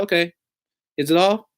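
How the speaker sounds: a cheery call-centre rep, imitated; mock phone agent. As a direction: play the agent wrapping up the call. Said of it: "And I was like".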